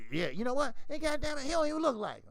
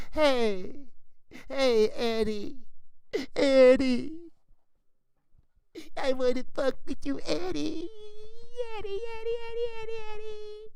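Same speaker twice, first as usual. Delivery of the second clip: high, loud, blubbering cry for Eddie; mock crying plea; 11 semitones higher, 7 dB louder than usual. Fighter mockingly crying back to Eddie Hearn for help. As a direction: wail the name like a crying child.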